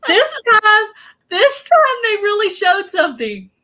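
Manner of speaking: words squeezed out through high laughter; can barely get it out for laughing. Real, not acted. Host tells chat she got flashed, laughing hard.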